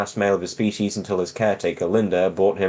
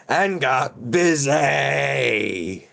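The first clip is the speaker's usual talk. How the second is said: "got busy" dragged out very slow, knowing; slow smutty aside in the story; suggestive innuendo; 6 semitones higher, much slower than usual. Acted. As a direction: let "got busy" hang with a wink. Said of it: "I should say".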